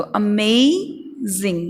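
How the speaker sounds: Amazing slowly as "uh-MAAAY... zing"; slow stress demo on MAY; stressed, drawn out. Shown as a demonstration. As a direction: stretch MAY in amazing, then pause. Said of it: "थोड़ा जोर देके".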